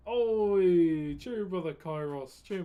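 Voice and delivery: loud hail to a regular viewer; greeting a regular viewer with a loud call. Real, not acted. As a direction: hail them across the room.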